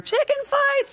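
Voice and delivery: very high mock party yelp; imitating an excited pool-party cry; poolside call-out. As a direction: squeal it out across the pool. Imitating poolside revellers calling out.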